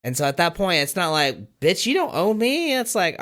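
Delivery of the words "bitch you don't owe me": mock indignation. Acted rejected retort, contrasted with conceding.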